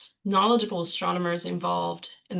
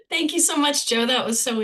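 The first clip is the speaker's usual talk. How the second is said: thanks through a laugh; 6 semitones higher, 4 dB louder than usual. Real, not acted. Laughing thanks after talk.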